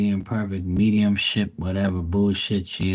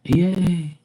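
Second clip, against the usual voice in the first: mock cheer; 8 semitones higher than usual. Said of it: "Your technician followed me".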